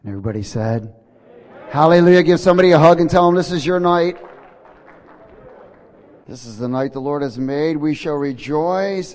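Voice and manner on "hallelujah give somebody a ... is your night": loud, high, fast call to the crowd; shouted hallelujah. Real, not acted. Pastor rousing the congregation, loud excited shout. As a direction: fire the room up, shouting.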